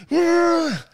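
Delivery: high, loud startled cry; startled yelp. Retold or imitated. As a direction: yelp like a man caught running. Inmate startled.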